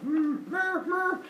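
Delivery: high imitated moo; cow mooing. Zeus pulling out a cow, imitated mooing.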